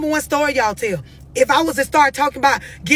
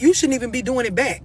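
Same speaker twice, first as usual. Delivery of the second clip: ordinary talk, nothing marked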